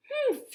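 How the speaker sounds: high scornful humph; disdainful humph. A narrator's reading. Maid sizing up the rabbit: scornful humph. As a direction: huff at the rabbit.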